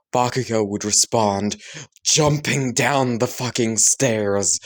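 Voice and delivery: usual speaking voice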